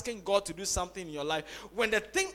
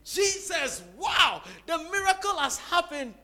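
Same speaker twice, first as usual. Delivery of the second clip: higher-pitched astonished shout; acting out a jubilant outcry; loud cry of praise; 7 semitones higher, 4 dB louder than usual. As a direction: cry out in amazed thanks. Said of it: "he cries with a loud voice".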